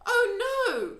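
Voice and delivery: high, loud cry of dismay. A narrator's reading. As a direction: cry out in alarm as the girl. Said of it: "cried Sophie".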